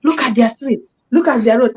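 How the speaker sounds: mock local complaint. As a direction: throw it out like an aggrieved bystander.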